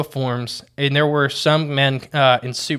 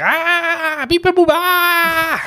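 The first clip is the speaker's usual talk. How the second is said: high robotic alien beeps; squeaky alien crying out; 5 dB louder, much slower than usual. Pretend. Alien voice after missile hit.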